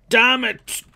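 loud high curse; frustrated shout. Real, not acted.

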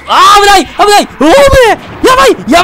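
real high shout of alarm; panicked yell. Real, not acted. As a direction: yell in panic as the hit comes. Narrowly dodging in game.

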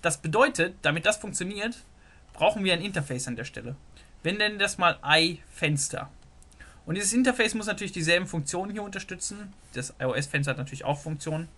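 normal talking voice

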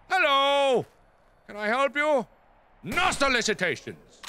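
mock shopkeeper. Greeting a zombie.

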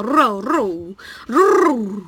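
rolled R buzz like a motorbike engine; rolled-r motor noise. Shown as a demonstration. Streamer imitating a motorbike for chat, rolled-R buzz. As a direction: roll the R like a bike revving. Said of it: "巻き舌そう、".

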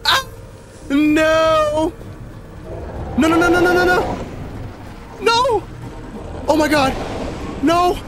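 very high, loud desperate shrieks; panicked screaming. Real, not acted. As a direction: scream in panic, so close to safety. Screams as monster chases the player.

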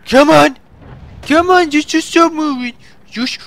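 high, urgent pleading. Real, not acted. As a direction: beg the tank to stop. Pleading with enemy tank.

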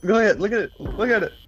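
high excited pointing. Real, not acted. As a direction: squeal it out. Excited at creature.

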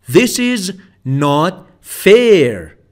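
normal talking voice

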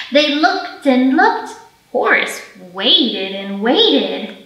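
lively picture-book storytelling for little ones; reading to children. Acted. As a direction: read it like story time at the library. Librarian reads picture book aloud.

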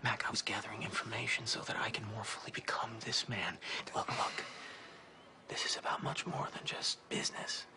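soft, breathy whisper of obsession; creepy confiding whisper. Acted. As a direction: draw them in with a hushed, unsettling intimacy. Creepy confiding about control.

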